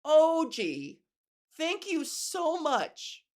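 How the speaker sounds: high, slow mock gratitude; exaggerated fake thank you; sarcastic thanks. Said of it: "And you're like".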